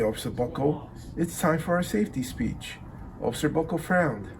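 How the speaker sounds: ordinary delivery, nothing marked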